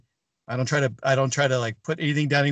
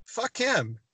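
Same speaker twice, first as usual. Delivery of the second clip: higher-pitched, drawn-out dismissal; retold defiance; 7 semitones higher, slower than usual. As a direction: throw the insult back, pitched up. Retold defiant reply in confrontation.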